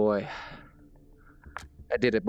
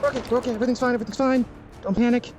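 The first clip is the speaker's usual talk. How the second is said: trying not to panic but failing; real panic, voice pitched way up; panicking. Real, not acted. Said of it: "I'm panicking".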